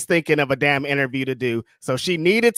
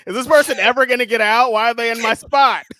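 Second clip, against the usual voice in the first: higher, fast, mock-annoyed waiting; impatient annoyance; 5 semitones higher, 5 dB louder than usual. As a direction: play the impatient traveller, a bit hammed-up. S1 acts her waiting for passenger.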